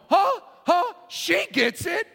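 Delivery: high, loud triumphant huh; Jesus gloating to his disciples; excited exclamation. Pretend. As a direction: nudge the disciples gleefully. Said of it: "looking at his disciples going".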